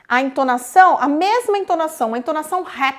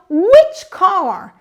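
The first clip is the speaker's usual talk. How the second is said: "Which" loud and high, "car" gliding down; musical rise on WHICH; 4 semitones higher, 6 dB louder than usual. Shown as a demonstration. Teacher shows the musicality, rise on which. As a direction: make it musical, leaning hard on "Which". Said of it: "percebe que tem uma musicalidade".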